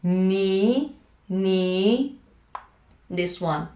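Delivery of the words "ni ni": "Ni" with a nasal n, gliding up. Shown as a demonstration. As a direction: sound "Ni" through the nose on a rising tone. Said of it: "Focus on the nasal cavity".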